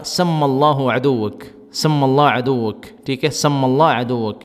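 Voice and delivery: very slow سم اللہ عدوک with a held double m and l; Gulf reply. Shown as a demonstration. Teacher modelling the blessing given in reply. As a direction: draw out سم اللہ عدوک syllable by syllable. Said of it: "جواب میں کہے گا".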